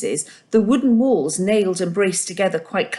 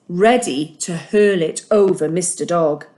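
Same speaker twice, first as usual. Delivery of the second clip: ordinary delivery, nothing marked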